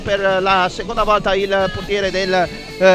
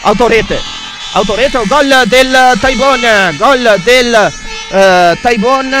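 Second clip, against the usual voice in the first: goal shout; 6 dB louder, slower than usual. Real, not acted. Goal call in live commentary.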